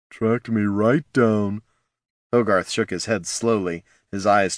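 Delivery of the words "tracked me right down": dazed barbarian. Acted. Dazed barbarian recalling past horror.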